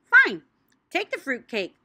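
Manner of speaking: loud fed-up burst on fine; grouchy. Acted. A hint of an annoyed mother giving up. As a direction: give in with a mild grumble. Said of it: "she grouched".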